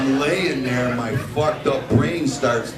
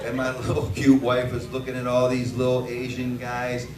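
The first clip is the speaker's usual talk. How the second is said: ordinary talk, nothing marked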